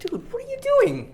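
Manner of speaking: very high, slow squeaky line. Acted. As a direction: squeak it out like a cartoon. Overdubbing a character line.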